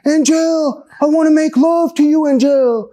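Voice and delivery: very high, hollered mock pleading; imitated lovesick yelling. Witness mimicking the neighbor banging on his walls, yelling.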